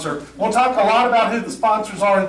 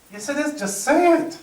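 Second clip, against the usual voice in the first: higher, exasperated insistence; exasperated insisting; 8 semitones higher than usual. Retold or imitated. To a stubborn castmate, higher exasperated insisting. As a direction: lose patience with him. Onstage quarrel, urging castmate.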